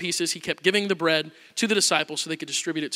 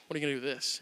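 much softer, lower sarcastic jab; 6 semitones lower, 13 dB quieter, much faster than usual. Retold or imitated. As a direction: toss it off dismissively. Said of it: "almost sarcastically".

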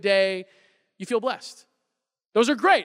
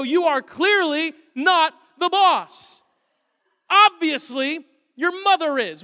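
slow, louder, mock-serious correction; mock stern dad; 6 semitones higher, 4 dB louder, slower than usual. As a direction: lay down the law, hammed up. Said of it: "I have to go".

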